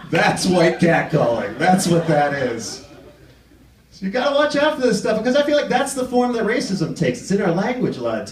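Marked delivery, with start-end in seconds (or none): 0.1-2.8 slow punchline. Acted. Punchline repeated slow and lower.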